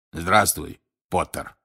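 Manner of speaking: скрипучий низкий голос; ответить скрипуче, голосом старого учителя; Грюм. Acted. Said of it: "ответил своим скрипучим голосом Грюм". (скрипучим голосом, пониже)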